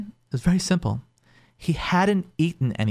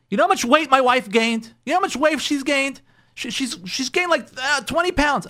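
a husband whining higher about weight; indignant husband; 11 semitones higher than usual. Retold or imitated. Husband telling the therapist, indignant and higher. Husband complaining, voiced in story.

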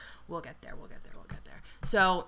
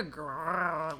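imitated drawn-out cat growl; slow, rolling sexual cat growl, mocking him; mock sexy cat noise; 3 semitones lower, 6 dB quieter than usual. Podcaster imitates his cat noise at her, mock sexy growl. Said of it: "he did a sexy cat noise".

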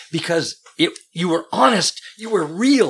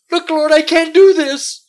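desperate cry; 12 dB louder than usual. Retold or imitated. Pastor voices Brian's desperate prayer.

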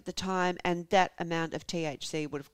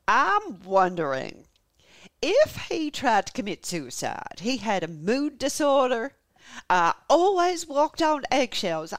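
slow, louder Southern drawl; American Southern accent; 3 semitones higher, 5 dB louder, slower than usual. Acted. Wife telling police about her husband, slow American Southern accent. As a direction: draw it out in a put-on Southern US accent. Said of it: "tired of doing the accent".

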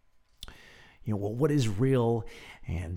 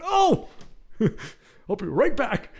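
high, loud, hammed-up excitement; retold eager outburst, pitched way up; eager exclamation; 12 semitones higher, 7 dB louder, slower than usual. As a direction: burst out laughing, keen to rush off. Acted eager dash for the Bible.

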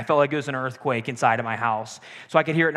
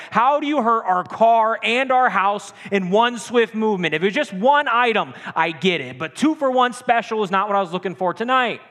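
higher, louder exasperated rant; freaking out; 7 semitones higher, 5 dB louder than usual. Retold or imitated. Husband ranting at his wife about the wrecked car and house, loud angry yelling. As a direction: vent your outrage at her. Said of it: "I'm freaking out".

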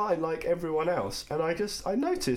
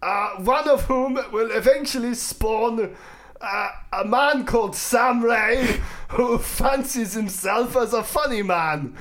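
high, loud ranting dictator; Hitler voice; 5 semitones higher, 5 dB louder than usual. Acted. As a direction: rant like an old dictator. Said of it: "You, Hitler over there".

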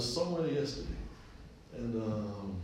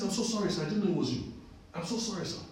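lightly voiced apologetic barber customer, higher; hurried mock apology, pitched up; apologetic man; 6 semitones higher, 3 dB louder than usual. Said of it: "he begins to apologize".

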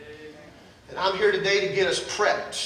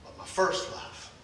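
1 whispered devotion; 7 dB quieter, slower than usual. Acted. Tender confession of faith.

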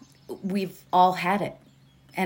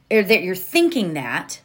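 usual speaking voice

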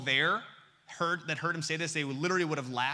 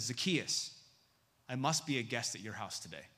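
tender; 6 semitones lower, 6 dB quieter than usual. Acted. Jesus speaking lovingly.

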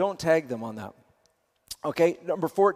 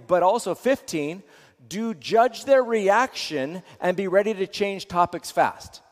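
ordinary delivery

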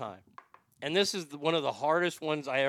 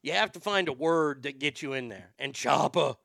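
normal talking voice

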